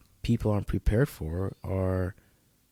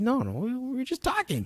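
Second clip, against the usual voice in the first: much higher mock girlfriend insisting; 14 semitones higher, 4 dB quieter than usual. Girlfriend insisting to boyfriend they were only talking, high mock voice. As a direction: squeak it out as his girlfriend, light parody. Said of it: "She's like".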